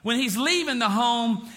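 usual speaking voice

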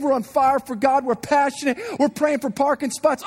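normal talking voice

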